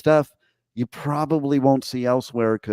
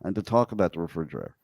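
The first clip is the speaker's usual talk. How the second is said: quoting self; 7 semitones lower, 5 dB quieter, much faster than usual. Retold or imitated. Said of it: "I said".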